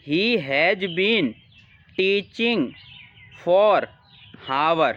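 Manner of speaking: slow reading of a fill-in-the-blank sentence; deliberate read-aloud. Acted. Reads fill-in sentence slowly.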